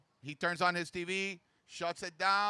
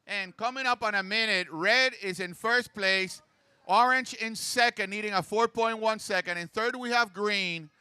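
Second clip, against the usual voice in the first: higher, louder standings call; announcer voice; 4 semitones higher, 6 dB louder than usual. Real, not acted. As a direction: rattle off the places to the crowd. Calls standings over the PA.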